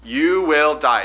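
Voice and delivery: slow, weighty "You will die." with the stress on "you"; a stern, emphatic warning. Shown as a demonstration. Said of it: "that's a very emphatic".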